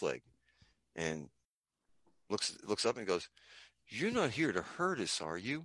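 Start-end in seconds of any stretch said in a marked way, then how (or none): 3.9-5.6 put-on soft, slow, timid child's question; little kid. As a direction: imitate a small kid asking quietly. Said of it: "this little kid was walking up to Fred".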